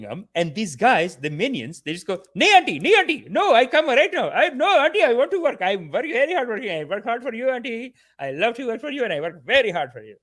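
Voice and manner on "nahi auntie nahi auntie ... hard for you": high, frantic imitated pleading; hammed-up plea, loud at first and then easing off; mock begging. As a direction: grovel to auntie for the job. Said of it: "They beg for the jobs".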